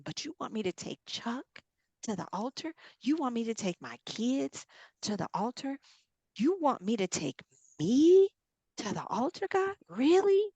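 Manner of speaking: rising, incredulous questions; pleading disbelief. Acted. Believer asking God about kids and altar, pleading disbelief. Acted disbelief at God's demand.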